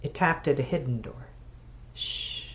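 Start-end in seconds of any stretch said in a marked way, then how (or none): 1.9-2.4 hushing. Acted. Hushing a sister's fantasy.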